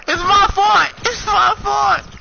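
wailing apology. Real, not acted. Teammate's mistake, wailing.

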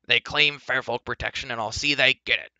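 loud, insistent dwarf voice; snappish. A narrator's reading. Dwarf demands protection for the humans, snapping. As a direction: lay it down forcefully. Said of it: "snapped the dwarf".

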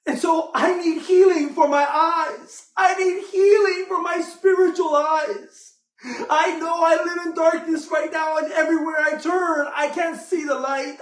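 high, loud impassioned preaching; a fervent sermon climax; preaching at full force. Acted. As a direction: pour it out like a pleading beggar. Preaching as the blind beggar.

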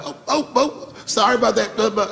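high-pitched comic backpedal; backpedaling police. Retold or imitated. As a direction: imitate flustered officers apologizing. Police backpedal on seeing mayor knows him.

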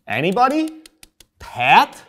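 a high, loud call into the room. Real, not acted. Host calling out to his crew for answers, loud and high. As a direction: shout the question out to anyone.

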